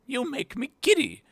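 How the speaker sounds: very high, peevish complaint; screechy queen. Acted. Queen complaining to the bowing gardeners, high screech. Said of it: "screamed the queen".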